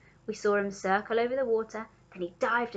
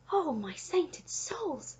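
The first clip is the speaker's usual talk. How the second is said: high, slow, breath-taken wonder; gasping; 7 semitones higher, much slower than usual. A narrator's reading. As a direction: gasp it out in awe. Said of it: "gasped the Giraffe".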